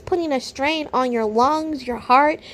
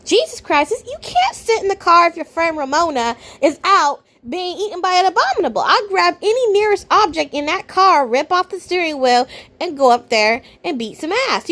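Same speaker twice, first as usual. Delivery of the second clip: normal talking voice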